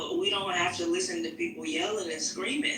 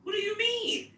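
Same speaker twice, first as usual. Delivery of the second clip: incredulous outburst. Real, not acted. To S2 who says he dislikes women, incredulous high outburst. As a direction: pitch jumps way up in disbelief. Outraged reply in argument.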